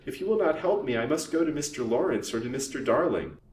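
hint at exposure to frighten her; sly. A narrator's reading. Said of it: "These sly words".

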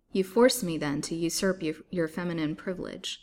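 plain reading voice

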